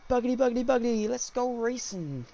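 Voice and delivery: race-start catchphrase. Acted. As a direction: call the racers off, high and sing-out.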